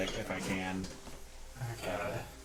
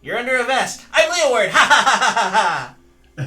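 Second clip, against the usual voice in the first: much higher, loud puppet punchline ending in a mock laugh; Leeward puppet cackle; 12 semitones higher, 14 dB louder than usual. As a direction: shout the pun then ham up a ha-ha laugh. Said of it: "I'll pop the puppet back up and go".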